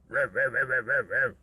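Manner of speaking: low growling demon. Acted. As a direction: groan it out like a demon. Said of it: "sa voix de démon".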